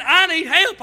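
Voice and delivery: put-on crying child. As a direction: voice the little girl crying "I need help". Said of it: "She said".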